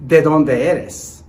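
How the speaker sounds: ¿De dónde eres? said slowly and loud; slow clear model question. Shown as a demonstration. Teacher showing the word order, slow loud model. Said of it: "Take a look at this particular question".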